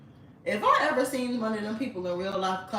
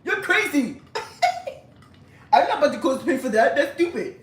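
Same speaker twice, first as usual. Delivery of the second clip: higher, louder scoffing laugh at her request; mocking laugh; 6 semitones higher, 8 dB louder than usual. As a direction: laugh her off like she's crazy.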